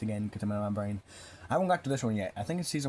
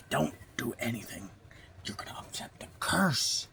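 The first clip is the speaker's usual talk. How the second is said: hushed whispered warning. Retold or imitated. Guy warning them not to touch, whispered. Guy warns in retold scene.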